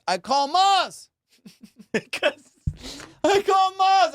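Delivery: high, loud, slow buildup; excited announcement. Real, not acted. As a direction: drag out the name. Excited setup of the call.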